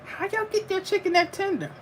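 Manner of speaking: high, soft falsetto asking in amazement; high-pitched mock amazement at the tender chicken; mock wonder. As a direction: squeak it out like an amazed customer. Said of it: "I'm like".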